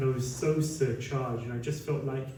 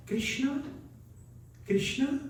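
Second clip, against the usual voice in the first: put-on much higher, questioning old lady's voice; old Swedish woman asking; 11 semitones higher than usual. Old Swedish woman asks the monk hopefully, high and questioning. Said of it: "And she just says".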